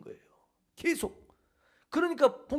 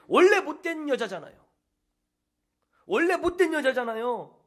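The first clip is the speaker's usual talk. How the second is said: louder, bitter retort, a touch higher; resentful retort; 6 semitones higher, 5 dB louder than usual. Retold or imitated. The young man answers God about his stepmother, resentful. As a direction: spit it out with resentment. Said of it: "청년이 대답을 했답니다".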